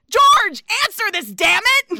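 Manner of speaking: high, loud mock yell down the phone; a frantic message bellowed at George; blaring demand. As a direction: imitate her yelling into the machine. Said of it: "Julia's voice just starts blaring".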